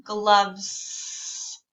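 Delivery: "Gloves" with the final s held long. Shown as a demonstration. Teacher modelling "Gloves" with a long final s. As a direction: stretch the s at the end of "Gloves". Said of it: "make the S sound a little extra long".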